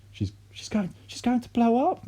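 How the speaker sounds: mother terrified grandma will explode; panicked wail. A narrator's reading. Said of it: "Missus Cranky wailed".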